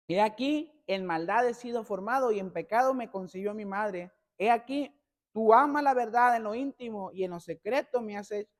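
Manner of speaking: loud, emphatic psalm reading; scripture reading. Acted. Preacher reads the psalm aloud to his congregation, loud and emphatic. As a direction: proclaim the verse to the congregation.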